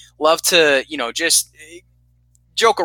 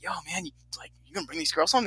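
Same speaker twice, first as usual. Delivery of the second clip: hushed aside; 4 semitones higher, 11 dB quieter, much faster than usual. Retold or imitated. Leaning in to ask Steve.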